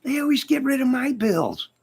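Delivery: high-pitched put-on lament; mock complaint. Comic complains his bills get scrapped, high mock lament. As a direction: complain in a squeaky, exaggerated voice.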